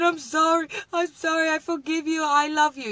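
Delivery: high, wailing mock sobbing apology; mock crying. Pretend tearful plea to a friend after a fight, high mock crying. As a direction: whine it out like a crying kid begging forgiveness. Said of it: "I'm gonna come crying".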